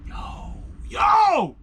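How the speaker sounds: high-pitched exclamation of amazement; soaring, awestruck yell; euphoric shout. Retold or imitated. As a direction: cry out as the rush hits. Reliving the euphoric rush.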